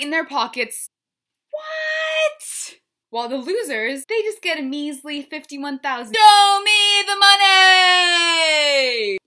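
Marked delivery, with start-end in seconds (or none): 1.5-2.8 very high surprised squeal; mock shock. Shocked at the prize money. 6.1-9.2 slow, very loud, high shout; movie-line shout. Pretend.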